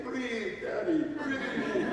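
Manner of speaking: put-on high, slow child voice with a laugh; a small girl coaxing her angry father to breathe; little daughter's voice. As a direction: play the little girl soothing her dad. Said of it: "hear my daughter say".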